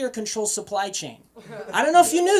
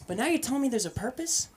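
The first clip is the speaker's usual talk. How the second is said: ordinary delivery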